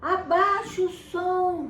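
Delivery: high, loud, slow drawn-out yell; mother yelling at her son to turn it down; mom yelling. Acted. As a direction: call up to him over the music. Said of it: "A mãe falava".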